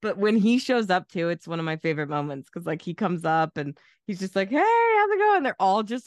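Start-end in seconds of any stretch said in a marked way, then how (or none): 4.5-5.4 high, loud mock-breezy hello; cheery greeting. Late guest greeting a tense dinner party, hammed-up cheery. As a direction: imitate an oblivious guest bursting in.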